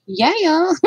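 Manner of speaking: playful YouTuber greeting copy; James Lee impression. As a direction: ham up the James Lee hello. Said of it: "I sound like James Lee".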